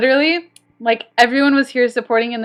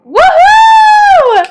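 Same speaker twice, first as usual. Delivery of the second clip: very high, loud joyful whoop; real victory whoop; 13 dB louder than usual. Real, not acted. Streamer just beat the night, whooping. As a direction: let out a huge triumphant cheer. Celebrating the win.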